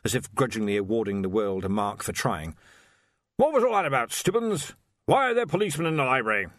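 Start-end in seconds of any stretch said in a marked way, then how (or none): 3.4-6.5 loud, high, blustering archchancellor; blustery old wizard. Acted. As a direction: demand it like a bluff old master. Ridcully's voice.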